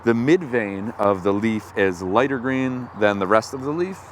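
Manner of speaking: normal talking voice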